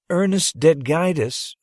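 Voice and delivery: ordinary delivery, nothing marked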